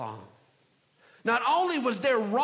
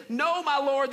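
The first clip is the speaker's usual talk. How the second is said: high, louder protest; pleading brothers; 7 semitones higher, 7 dB louder than usual. Acted. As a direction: protest innocence to a lord. Brothers protest their innocence to Joseph.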